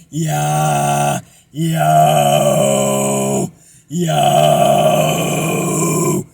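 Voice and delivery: very low rough fry, into a fan. Shown as a demonstration. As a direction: growl like talking into a fan. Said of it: "talking through a fan".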